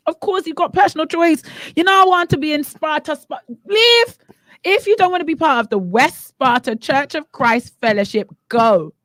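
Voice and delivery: high-pitched, worked-up protest; indignant outburst; exasperated rant. Real, not acted. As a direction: raise the pitch and let the exasperation out. Heated rant, mock pleading to leave.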